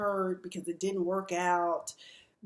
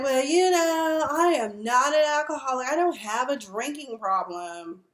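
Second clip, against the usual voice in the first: mocking drunk denial; 5 semitones higher, 5 dB louder than usual. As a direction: imitate someone in denial.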